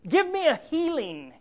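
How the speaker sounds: high, hammed-up pleading with God; David crying out. Preacher paraphrases David's prayer for healing, crying out. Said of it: "he cries out in a very practical sense".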